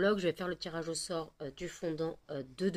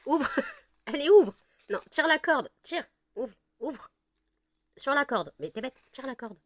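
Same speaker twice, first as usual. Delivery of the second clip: much higher and louder, cheering the dog on; excited urging; 7 semitones higher, 5 dB louder than usual. Real, not acted. An owner cheers her dog on to open the draw bag, higher and louder. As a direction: egg her on to pull the cord.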